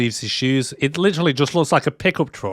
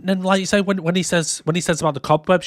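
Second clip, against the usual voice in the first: ordinary talk, nothing marked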